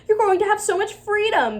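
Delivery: high, sing-song mocking voice; mocking adults. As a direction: parody the cheery adults. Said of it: "they said".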